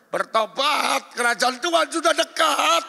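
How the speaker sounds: loud, high, drawn-out cry of warning; mock preaching shout. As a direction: shout it like a fiery call to repent. Acts scolding counsellor shouting repentance. (teriakan seruan bertobat yang panjang)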